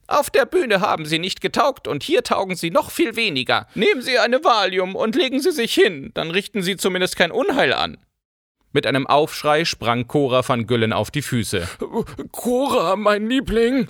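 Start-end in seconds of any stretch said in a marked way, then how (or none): 0.1-8.0 put-on scornful old baroness. A narrator's reading. 12.3-13.9 much higher, imploring plea; pleading husband. A narrator's reading. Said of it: "flehte der Bankier".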